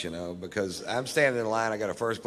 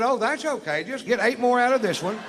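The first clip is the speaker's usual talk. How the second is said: put-on higher, louder, talking-to-a-child tone; condescending, singsong, patient lesson; patronizing explainer; 11 semitones higher, 4 dB louder than usual. Teaching the clerk to count.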